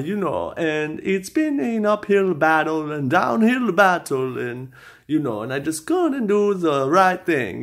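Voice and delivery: put-on amateur Borat accent ranting about the courts. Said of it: "I'm gonna do it in the Borat accent".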